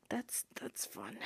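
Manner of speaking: slow unvoiced whisper; whispered sarcastic fun. Real, not acted. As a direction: hush it like a wry aside. Sarcastic aside on punishment.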